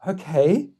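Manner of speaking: rising, doubtful mock acceptance; taken-aback okay. Replying to the refusal, imitated unsure okay.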